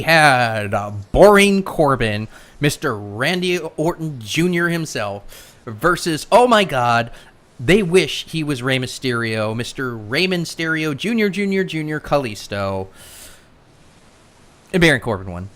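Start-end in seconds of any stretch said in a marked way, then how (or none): none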